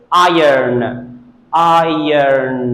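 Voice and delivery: IRON said EYE-urn, slowly; correct pronunciation. Shown as a demonstration. Teacher models the word for students, correct pronunciation. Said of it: "but this is".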